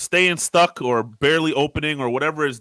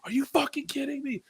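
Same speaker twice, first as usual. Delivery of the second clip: high, pitched-up mock rant at the broken fridge; retold yelling; 12 semitones higher than usual. Husband retells yelling at warm fridge, high mock rant. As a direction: replay your own angry outburst, higher. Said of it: "this is how our conversation is going".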